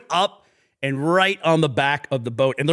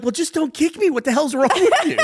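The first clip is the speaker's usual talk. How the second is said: dog voice; 13 semitones higher, 4 dB louder than usual. Retold or imitated. Dog voiced in owner's story.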